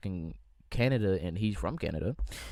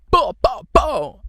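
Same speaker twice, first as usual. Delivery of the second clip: loud, high mock gunshot bows; loud imitated gun blasts; dancehall gunshots; 12 semitones higher, 12 dB louder, slower than usual. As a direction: shout it like a dancehall DJ firing shots. Gunshot sounds after dancehall toast.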